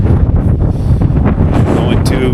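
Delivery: shouting over wind, freezing cold; cold and windy. Real, not acted. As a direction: talk loud against cold wind. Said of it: "I'm freezing my balls off".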